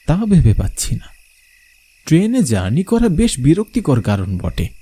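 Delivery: ordinary delivery, nothing marked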